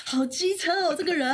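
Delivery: high, loud, put-upon whine; exasperated exclamation. Real, not acted. Co-host reacting to the lemon-hater, exasperated and high. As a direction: groan at how petty he is.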